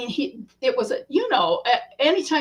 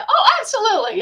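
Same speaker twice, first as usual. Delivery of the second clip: eager yes; 10 semitones higher, 5 dB louder, much faster than usual. Retold or imitated. Dee agreeing to photo.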